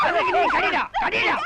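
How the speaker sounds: very high, urgent cry; high-pitched scuffle yell; frantic shouting. Acted. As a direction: shout it repeatedly in the heat of a scuffle. Scuffle with supposed thief.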